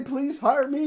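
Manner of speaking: high, pleading plea; a high, over-the-top plea; overeager begging. Shown as a demonstration. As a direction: beg like a jumpy kid. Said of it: "jump up and down and say".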